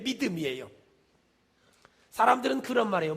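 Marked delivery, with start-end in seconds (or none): none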